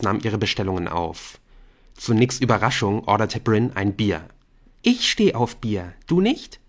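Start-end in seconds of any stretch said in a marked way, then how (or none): 4.8-6.6 high flirty coo. A narrator's reading. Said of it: "gurrte sie".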